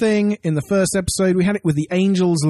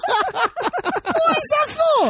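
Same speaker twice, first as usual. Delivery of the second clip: high, slow laughing repeat; much slower than usual. Acted.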